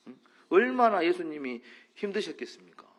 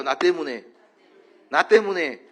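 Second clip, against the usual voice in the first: louder, forceful repeat; strong emphatic; 7 dB louder than usual. Shown as a demonstration. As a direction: say it hard and strong. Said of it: "세게 쳐봐요". (세게 힘주어)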